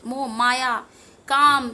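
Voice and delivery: ordinary talk, nothing marked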